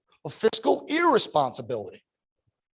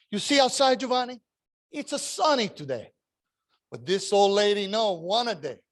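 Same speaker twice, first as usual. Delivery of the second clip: put-on heavy Italian accent. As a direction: warn the boy about rainy days in a thick Italian accent. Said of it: "This heavy Italian accent".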